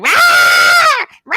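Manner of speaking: imitating Monique shouting on stage; imitating loud host. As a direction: bellow it like an over-the-top host. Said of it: "and she's like".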